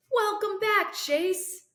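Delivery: high, bright hearty doctor's greeting; exclaiming. Acted. Doctor bursts in and greets the waking patient, high and hearty. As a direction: greet the patient with big cheer. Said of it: "he exclaims".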